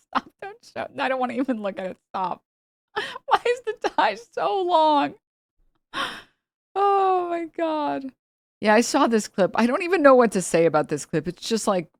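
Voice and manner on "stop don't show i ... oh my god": much higher, pleading stop; squealing disgust. Real, not acted. Reacting to a gross clip, squealing. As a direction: recoil in horrified laughter.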